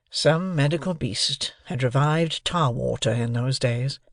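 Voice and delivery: ordinary delivery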